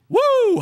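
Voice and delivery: very high shouted woo, hammed up. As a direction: yelp it like a bad impression. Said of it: "check out my new impression of [name]".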